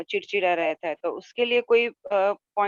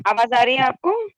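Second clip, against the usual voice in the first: raised, higher call over the line; 6 semitones higher, 6 dB louder than usual. Real, not acted. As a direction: push the question through a bad connection. Audio check again on call.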